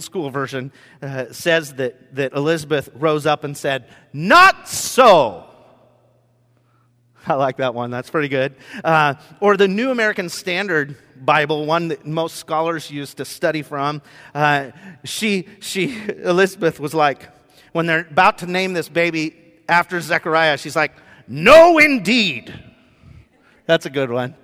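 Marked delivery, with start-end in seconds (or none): 4.1-5.4 loud, punched proclamation; emphatic objection. Retold or imitated. Elizabeth objects to the baby's name, loud and emphatic. 21.3-22.5 loud, high, drawn-out outburst; emphatic refusal. Retold or imitated. Elizabeth refuses the baby name, emphatic high outburst. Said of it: "and it's emphatic".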